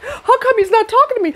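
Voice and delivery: high, whiny mock voice with a laugh; mock the clingy girlfriend. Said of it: "you're gonna be like".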